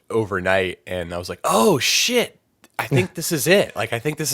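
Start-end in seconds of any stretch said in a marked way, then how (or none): none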